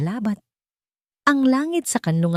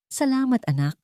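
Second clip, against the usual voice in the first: usual speaking voice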